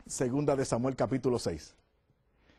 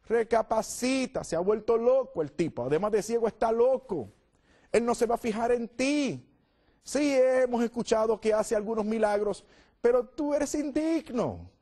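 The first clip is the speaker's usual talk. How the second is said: higher, mocking bystander telling him off; scolding crowd; 6 semitones higher than usual. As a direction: talk down to the beggar, scornful.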